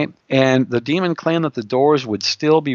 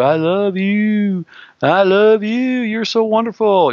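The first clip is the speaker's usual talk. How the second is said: gushing flatterer; 8 semitones higher, 3 dB louder, slower than usual. Retold or imitated. Mimics seductive flattery.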